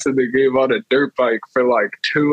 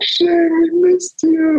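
much higher, slow, syrupy mock greeting; sappy welcome; much slower than usual. Friends greeting him after his bad trip, sugary high voice. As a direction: coo it like welcoming a lost pet home. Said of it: "We're like".

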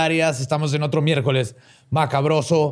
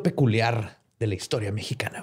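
whisper; 5 semitones lower, 5 dB quieter than usual. Acted. Mysterious aside.

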